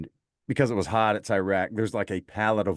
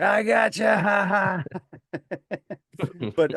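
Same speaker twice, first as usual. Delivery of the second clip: raucous laughter; 11 semitones higher, much slower than usual. Retold or imitated. Sergeant revealing the prank to the kid, raucous laughing. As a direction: imitate a big roaring laugh. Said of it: "burst out in the most raucous laughter".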